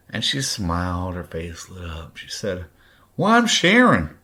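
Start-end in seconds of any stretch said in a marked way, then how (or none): 3.2-4.2 high, loud mocking Kentucky twang; hillbilly Kentucky accent. Ex-stepmother introducing herself, mock Kentucky hillbilly accent. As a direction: imitate a hillbilly woman, pitched way up. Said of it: "with her ignorant hillbilly Kentucky fucking accent".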